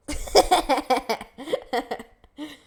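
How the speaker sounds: loud rising burst of laughter; big laugh. Real, not acted. Showing off her cute pipe, bursting into loud laughter.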